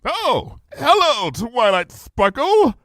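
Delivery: high, slow, falsely bright greeting; forced cheerfulness. Acted. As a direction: greet her with strained friendliness. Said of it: "with forced conviviality".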